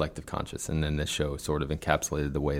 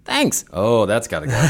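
sudden high "Thanks" then a brush-off; dismissive producer; 7 semitones higher, 7 dB louder, slower than usual. Retold or imitated. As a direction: praise turns to rejection in a breath. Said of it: "it's like".

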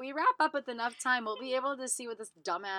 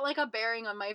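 usual speaking voice

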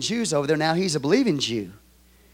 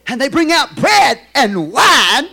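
louder, higher, slowed proclamation; full-force preaching; 6 semitones higher, 7 dB louder, slower than usual. Acted. Pastor proclaims the bread and wine, preaching loud. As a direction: proclaim it to the whole congregation.

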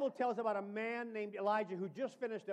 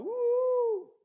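high, loud woo; mock-thrilled woo; excited whoop; 13 semitones higher, 7 dB louder than usual. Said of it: "I'd have thought".

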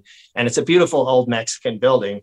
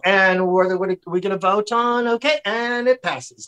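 high, singsong mock official; rubber-stamp it in a put-on high voice; indifferent council official; 7 semitones higher than usual. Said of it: "no matter what the people say, they just go".

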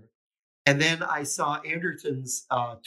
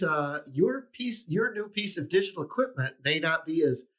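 normal talking voice

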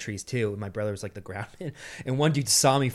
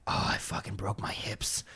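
retold pained thought; 3 semitones lower, 8 dB quieter than usual. Replays his thoughts after the fall.

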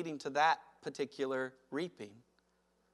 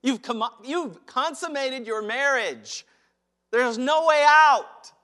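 ordinary talk

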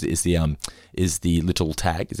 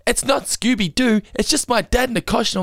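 put-on tattling kid; 13 semitones higher than usual. Son telling other kids about dad's costume, tattling. Imitating his kid spoiling the illusion.

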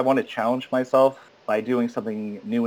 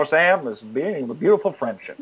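old film-star impression; Humphrey Bogart; 2 semitones higher than usual. Actor quoting Casablanca to Sam, film-star impression. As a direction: put on a Casablanca leading-man voice. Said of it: "do Humphrey Bogart voices".

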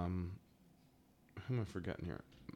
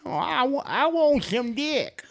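put-on high, slow old woman voice; old lady voice; 4 dB louder, slower than usual. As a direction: drag it out like a randy granny.

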